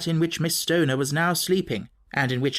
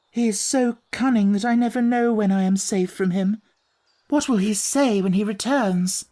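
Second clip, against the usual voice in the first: put-on higher young woman's voice, anxious; frightened young lady; 6 semitones higher than usual. Miss Stoner fearing her stepfather's return, anxious. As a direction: let her fear of him show.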